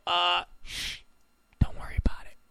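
whispered brush-off, shh; hushing whisper. Retold or imitated. American hushing the curious foreigner, whispered. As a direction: hush them in a whisper.

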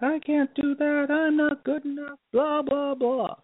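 high, slow whiny inner voice; whiny self-doubt voice. Retold or imitated. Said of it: "where I'm like".

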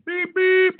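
high, loud mock beep beep like a call waiting tone; imitated phone beep. Teller mimics the call-waiting beep, high and loud. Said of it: "it's, like, beeping in".

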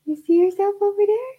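high, sing-song question to an infant. Real, not acted. Mom cooing at the baby.

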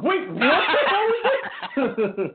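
high, slow, laughing disbelief. Retold or imitated. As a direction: act baffled through a laugh.

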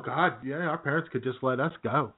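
ordinary talk, nothing marked

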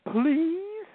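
high, loud pleading please; a child's loud high whine for a cookie; begging child. Retold or imitated. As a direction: beg like a small child. Daughter begging in story.

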